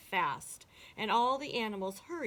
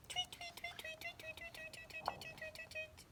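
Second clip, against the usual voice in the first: rapid string of soft, very high chirps; tiny birds' soft twittering; birds tweeting; 13 dB quieter than usual. A narrator's reading. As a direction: chirp over and over on one high note. Bird calls in the story.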